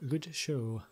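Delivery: ordinary talk, nothing marked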